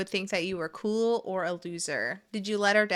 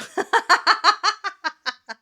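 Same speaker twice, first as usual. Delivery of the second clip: high loud laugh; 12 semitones higher, 10 dB louder than usual. Real, not acted. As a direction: burst into a high, loud cackle. Bursts out laughing.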